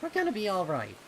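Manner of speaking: put-on high, loud, hopeful boyish voice; young colt voice. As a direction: kid pony finding courage. Voices Snips, smiling.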